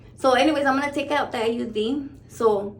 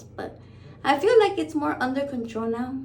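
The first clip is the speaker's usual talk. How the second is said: usual speaking voice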